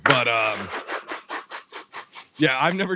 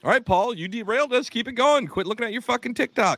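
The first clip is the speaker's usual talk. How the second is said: playful scolding; 10 semitones higher than usual. Real, not acted. Host teasing Paul for scrolling TikTok, mock scold. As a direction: call him out, half laughing, half nagging. Teasing cohost about his phone.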